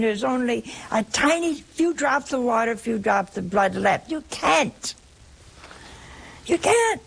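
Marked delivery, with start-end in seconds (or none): 4.1-4.9 higher, emphatic. Acted. Preacher's emphatic rhetorical answer. 6.4-7.0 much higher, ringing insistence; emphatic high cry. Acted. Preacher insisting to congregation, high ringing cry.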